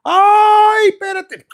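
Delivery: high, loud mock cry of pleasure; woman's outcry. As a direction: cry out high, drawing out the first word. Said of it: "I love when a woman just".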